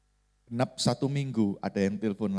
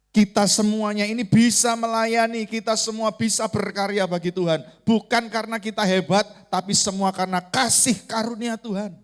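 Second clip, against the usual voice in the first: raised-pitch, driving sermon delivery; emphatic preaching; 7 semitones higher, 5 dB louder than usual. Real, not acted. Preacher urges congregation on serving God, emphatic and raised. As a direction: press the point home to a congregation.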